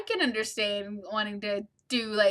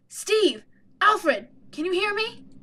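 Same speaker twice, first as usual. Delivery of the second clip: calling out; 7 semitones higher, 3 dB louder, slower than usual. Acted. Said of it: "I scream their names".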